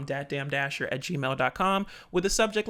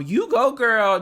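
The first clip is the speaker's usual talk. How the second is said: high, loud catchphrase; punchy, high quoted hurrah; mock cheer; 5 semitones higher, 8 dB louder, slower than usual. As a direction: hoot it like a playful cheer. Quotes the comment once more.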